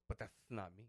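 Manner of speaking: soft mock lisp on "that's"; lightly imitated lisping brush-off. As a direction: lisp it like the sassy trainer. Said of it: "He had a lisp".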